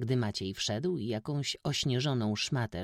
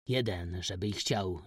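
ordinary delivery, nothing marked

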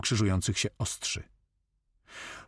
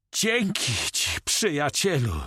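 high, drawn-out cry of thanks; shouting; 7 semitones higher, slower than usual. A narrator's reading. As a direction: call it out high and slow. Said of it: "krzyczał Carmen".

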